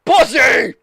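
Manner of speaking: loud, high mocking yell; taunting yell. Real, not acted. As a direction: jeer again. Taunting friend who refused.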